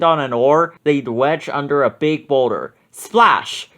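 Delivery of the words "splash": usual speaking voice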